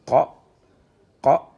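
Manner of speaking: short loud syllable, pause, repeated; short isolated "qa," for learners to copy; detached reading. Shown as a demonstration. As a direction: drill "qa," firmly, each one separate. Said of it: "on est en détaché".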